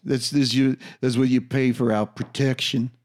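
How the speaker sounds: a lightly put-on mob accent, barely shifted; half-hearted attempt at a New York mobster accent; mild mock mobster accent, badly faked; bad gangster accent. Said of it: "I don't know what accent that was".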